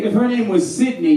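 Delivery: normal talking voice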